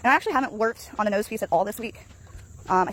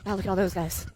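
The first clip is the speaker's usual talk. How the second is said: ordinary talk, nothing marked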